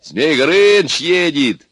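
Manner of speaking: high, loud, drawn-out cries; joyful shouting. A narrator's reading. Guards cheer Zmey Gorynych's arrival, shouting. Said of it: "закричали они".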